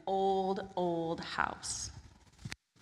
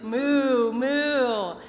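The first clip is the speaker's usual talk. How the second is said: cow mooing; 4 semitones higher, 9 dB louder than usual. Acted. Animal noise for the cow.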